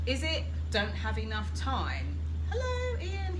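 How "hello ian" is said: Ian's greeting. Retold or imitated. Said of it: "He says".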